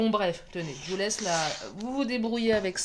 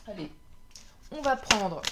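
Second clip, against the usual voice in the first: usual speaking voice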